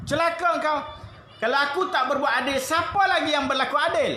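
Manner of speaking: the Prophet rebuking a rude bedouin; forceful rebuke. Acted. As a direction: hurl the question back at him.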